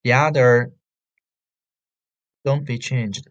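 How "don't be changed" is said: ordinary delivery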